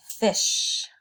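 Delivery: "fish" and "Ish" whispered to isolate the vowel; hushed phonics demo of "Ish"; whispered sounding out. Shown as a demonstration. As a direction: whisper the word, then just its vowel. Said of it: "Do you hear the I sound?".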